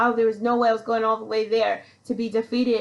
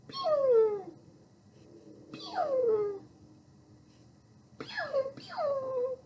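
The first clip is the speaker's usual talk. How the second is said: tiny high pew, pew sounds, each one fading softer; imitated BB gun pew; 5 dB quieter, much slower than usual. Mimicking BB guns fired down into the car pit, high pew sounds. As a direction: mimic little pellets zipping past. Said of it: "we start hearing this little pew".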